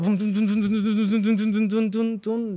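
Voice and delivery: rapid run of mock notification pops; imitated message pings. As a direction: tap out the flood of incoming messages. Imitating WhatsApp messages flooding in. (imitando el sonido de mensajes que llegan sin parar)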